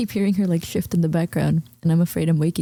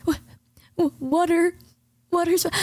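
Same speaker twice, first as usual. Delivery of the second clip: nervous stammer; 9 semitones higher than usual. Retold or imitated. Nervous customer ordering at Hooters.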